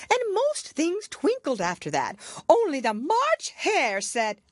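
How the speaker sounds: high, loud flustered babble; nervous Hatter. Acted. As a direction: gabble it out anxiously. Hatter voiced.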